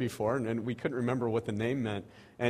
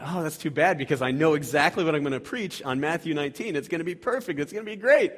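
mock excited; 5 semitones higher, 5 dB louder than usual. His own retold excited thought.

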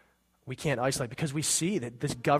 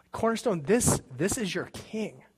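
higher, slow, fervent proclamation; emotional declaration; 6 semitones higher than usual. Real, not acted. Pastor proclaiming Jesus as king to the congregation, fervent and slow. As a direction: lift it up to the church, slow and full of feeling.

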